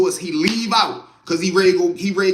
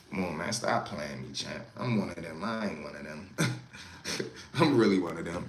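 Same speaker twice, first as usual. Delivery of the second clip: much lower, growly joking voice; deep goofy voice; 14 semitones lower, 11 dB quieter than usual. Retold or imitated. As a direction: drop way down into a silly growl. Sudden deep put-on voice.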